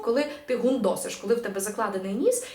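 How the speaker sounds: usual speaking voice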